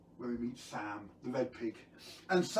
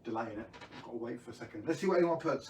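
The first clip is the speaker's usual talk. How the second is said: usual speaking voice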